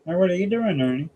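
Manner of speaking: lightly voiced frog muppet; Kermit impression. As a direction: answers Ernie in a light frog-puppet voice. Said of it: "have 'em talk to each other".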